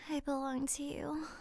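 normal talking voice

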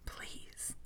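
breathy seductive whisper, dropping away to almost nothing; sexiest voice. Retold or imitated. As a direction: purr it out as seductively as you can. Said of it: "in the sexiest voice I could muster".

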